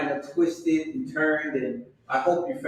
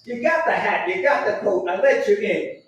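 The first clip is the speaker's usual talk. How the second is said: ordinary delivery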